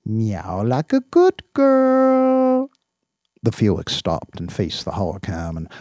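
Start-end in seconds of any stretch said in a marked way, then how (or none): 0.0-2.7 put-on squeaky, very slow baby talk; very high slow coaxing. Owner coaxing his cat, high baby talk.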